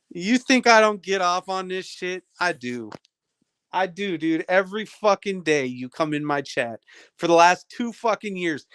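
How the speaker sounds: ordinary talk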